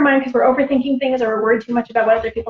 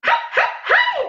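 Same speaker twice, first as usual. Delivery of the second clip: very high, short repeated calls; imitating mom's call. Mom calling out so family can find her, high yips. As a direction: mimic a mother's high signal call. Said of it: "I can't do my mom's voice".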